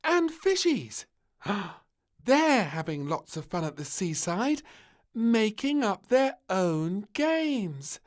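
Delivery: high, slow, gasping delight; delighted wonder. Acted. Little Princess at the rock pool, high slow wonder. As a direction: marvel at the fish.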